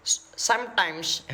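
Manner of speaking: put-on slow Indian-accented English; Zakir Naik. Mimicking a lecturer for viewers, Indian accent. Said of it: "kalau kalian dengar Dokter Zakir Naik".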